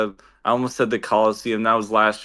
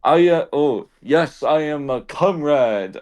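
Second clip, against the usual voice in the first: halting, slow, higher fake Russian accent; attempted Russian accent; 4 semitones higher than usual. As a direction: stumble along in a bad Russian accent. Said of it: "tried to do a Russian accent".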